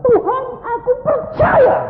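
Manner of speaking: imitated high, loud wail; childlike tearful cry. Preacher imitating a woman crying out her faith, childlike wail. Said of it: "dia nangis seperti anak kecil".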